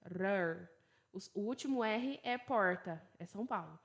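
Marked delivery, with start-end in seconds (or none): 0.0-0.7 Rr as a tapped r like American t; teacher showing the flap; rolled r sound. Shown as a demonstration. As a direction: tap the r sound alone. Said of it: "o T vira o R".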